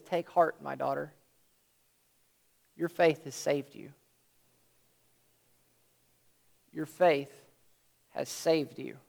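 soft compassionate reassurance. Retold or imitated. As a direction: comfort a frightened woman gently. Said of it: "the compassion in his voice when he says".

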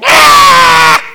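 high wordless yell. Retold or imitated. Yelling at a scam caller over Bluetooth, loud scream. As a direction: blast a sudden scream down the phone. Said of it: "I started yelling".

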